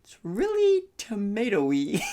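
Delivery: a high, slow, put-on voice for the friend; slow mock voice. The friend tasting the sauce, in a slow high put-on voice. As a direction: drag out "really" like a disappointed taster.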